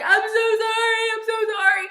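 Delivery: high, half-sung mock-frantic sorry; imitating panic. Mom calling from Hawaii, high mock-panicked apology. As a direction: wail the apology up high like a worried mother. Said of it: "absolutely panicking".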